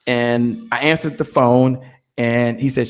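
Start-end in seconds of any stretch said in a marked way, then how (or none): none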